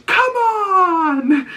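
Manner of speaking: very high, drawn-out mock cheer; imitated cheering shout. Woman rooting for Dunk after his slap, high cheering shout. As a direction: shriek it like an overexcited supporter.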